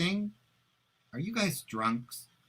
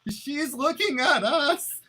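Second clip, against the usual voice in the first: panicked; 11 semitones higher, slower than usual. Acted. Sees the wife staring at them.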